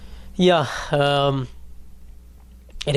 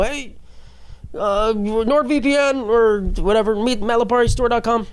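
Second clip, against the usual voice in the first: slow, higher ad-announcer voice; mock ad read; 7 semitones higher than usual. Pretending to voice ads fading into his podcast, mock announcer. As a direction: ham up a podcast sponsor read. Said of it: "and I go".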